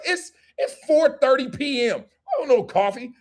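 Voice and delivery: high, whiny protest; mock incredulity. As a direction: balk at the invitation in a put-on high voice. Acts baffled reply to coffee invitation.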